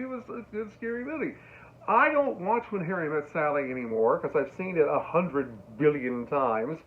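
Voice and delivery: usual speaking voice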